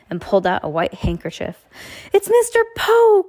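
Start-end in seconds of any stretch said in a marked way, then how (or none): 2.1-3.3 high, loud cry of recognition; joyful shout at a much higher pitch; excited cry. A narrator's reading. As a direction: call it out, thrilled to see him. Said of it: "Klaus cried".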